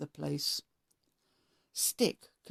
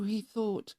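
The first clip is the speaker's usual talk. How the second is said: ordinary delivery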